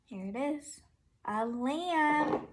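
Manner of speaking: ordinary talk, nothing marked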